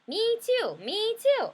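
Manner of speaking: high pestering little sister chant. Acted. Voicing sister's refrain.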